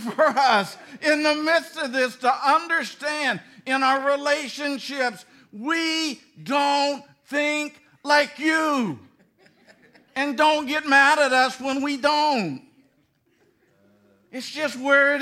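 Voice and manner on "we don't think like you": slow emphasis. Real, not acted. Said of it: "slow emphasis".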